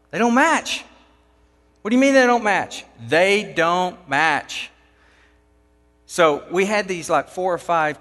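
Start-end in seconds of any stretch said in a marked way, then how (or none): 0.1-0.8 insistent repeat. Retold or imitated. Worker repeats his find. 1.8-2.8 higher, louder and fast; can't believe what he's hearing; rapid, disbelieving question; incredulous. Retold or imitated. Crew reacts in disbelief. 3.1-4.7 very slow, spelled out word by word; slow, deliberate insistence; drawn-out repeat. Retold or imitated. Worker repeats, drawing it out.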